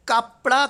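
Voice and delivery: कपड़ा called out loud, rising at the end; singular called out. Shown as a demonstration. Teacher loudly reading out the singular कपड़ा for the class. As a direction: lift and punch कपड़ा like a question. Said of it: "कपड़े आना चाहिए था".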